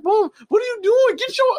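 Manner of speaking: high shrill car yell; mock road rage yell. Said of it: "He's like".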